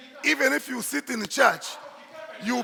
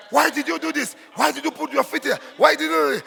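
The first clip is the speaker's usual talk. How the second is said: mock scolding; 3 semitones higher than usual. Preacher imitating someone blaming Peter for his past, scolding. Acting out a scolding God won't give.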